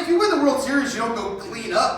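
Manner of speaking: usual speaking voice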